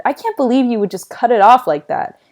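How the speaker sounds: snotty. A narrator's reading. Sister leaving brother's room after the braid row, snotty. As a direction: scold with a snotty edge. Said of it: "she added snottily".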